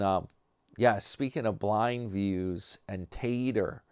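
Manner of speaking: usual speaking voice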